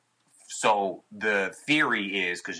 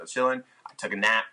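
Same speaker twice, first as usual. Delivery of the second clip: ordinary delivery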